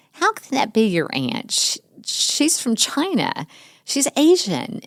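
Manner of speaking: high, slow, taunting schoolkid; mean kid challenging the girl about her aunt; mocking kid. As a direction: sneer it like a mean classmate.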